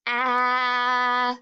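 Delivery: "ah" as a long level short-a, as in bat; isolated vowel sound. Shown as a demonstration. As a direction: stretch "ah" on one steady note. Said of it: "the ah sound".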